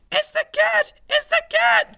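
very high, loud mouse shriek; panicked cry. A narrator's reading. Scared mouse spots a stripy tail, shrieking in panic. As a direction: squeal the alarm at the top of your voice. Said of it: "cried Squeak".

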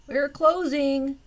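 high, loud, slow guide's announcement; guide calling out. Acted. Guide calling closing time to visitors. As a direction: sing it out like a tour guide.

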